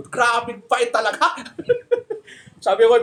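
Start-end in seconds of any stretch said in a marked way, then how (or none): 1.4-2.2 laughs. Real, not acted. Laughing at own story.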